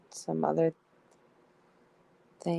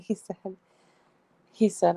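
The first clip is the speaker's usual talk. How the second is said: ordinary talk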